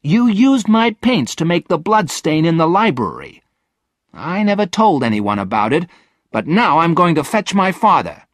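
put-on high, loud girl's accusation; angry, high outburst; indignant outcry. A narrator's reading. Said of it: "cried Virginia".

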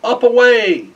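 high, loud command to the reindeer; a big rousing cry to take off; shouting. A narrator's reading. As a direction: Santa urging his reindeer into the sky.

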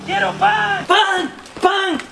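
high, insistent pleading calls; desperate calling out. Acted. Customer begs the panadero for bread, calling desperately. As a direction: keep hollering after the departing seller.

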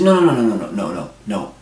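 patient corrects the receptionist, stacking up loud no's; insistent repeated no. Retold or imitated. Patient corrects the receptionist, mock insistent and loud.

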